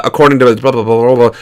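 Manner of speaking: mock chatbot answer. Chatbot answering an abusive user, low mock drone.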